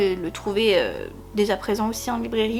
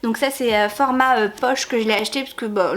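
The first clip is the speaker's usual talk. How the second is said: usual speaking voice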